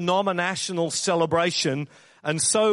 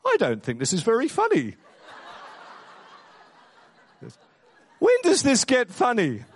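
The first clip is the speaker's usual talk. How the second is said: put-on higher Irish-accented complaint; little Irish voice; 6 semitones higher than usual. Wife interrupting a brutal film, little Irish voice. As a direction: put on a small, high Irish voice. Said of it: "this little Irish voice pops up".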